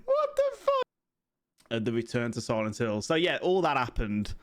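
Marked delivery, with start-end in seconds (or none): 0.0-0.8 high, strained crying squeal; mock-wail it in shock; a half-crying shriek of disbelief. His reaction to a film scene.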